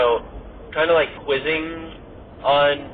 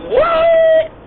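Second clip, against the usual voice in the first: squeaky high shocked "What?"; 9 dB louder than usual. Retold or imitated. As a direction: yelp it out in disbelief. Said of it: "I was like".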